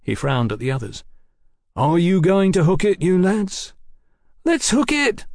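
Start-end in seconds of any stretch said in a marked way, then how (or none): 1.8-3.7 raised, higher-pitched challenge; challenging. A narrator's reading. Sergeant challenges the gang. 4.4-5.2 very high-pitched whine; frightened crook whining to run away. A narrator's reading. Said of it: "whined Bob Miles".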